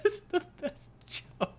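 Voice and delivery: high, soft, slow words lost in laughter; breathless laughter. Real, not acted. Joke teller praises his own joke, helpless laughter. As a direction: wheeze it out while cracking up.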